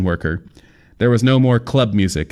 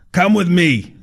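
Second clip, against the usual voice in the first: fat man voice; 10 semitones higher than usual. A narrator's reading.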